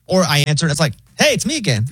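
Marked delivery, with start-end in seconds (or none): 1.2-1.9 high-pitched, breezy AI voice; chill robot operator. Acted. Pharmacy phone AI greets a caller, chill. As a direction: chirp it like a laid-back automated assistant. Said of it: "the A I operator, like, all chill".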